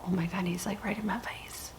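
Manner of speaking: hushed unvoiced whisper. Real, not acted. Whispering about the cat right in her face. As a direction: whisper so he won't notice.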